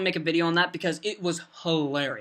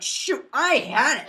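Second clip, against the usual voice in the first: higher, drawn-out frustrated outburst; mock mad; 5 semitones higher, slower than usual. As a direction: act out exasperation, slowly and higher. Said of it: "I was getting so mad".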